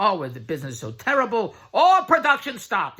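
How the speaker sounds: mock the friend's doom and gloom; mocking complaining friend.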